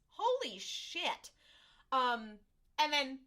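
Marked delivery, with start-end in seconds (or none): 0.1-1.3 slow, high, drawn-out holy shit; stunned exclamation. Retold or imitated. Recapping the show, she mimics a stunned character with a slow high holy shit. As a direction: let it stretch out in disbelief.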